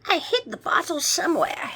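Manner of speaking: high, whispery vampire mutter; breath-heavy pondering vampire. A narrator's reading. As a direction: mutter to yourself as an old vampire. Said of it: "he muttered".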